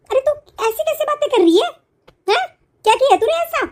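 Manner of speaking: high, outraged disbelief; how dare she talk like that; shocked outcry. Acted. Shocked reaction, different voice.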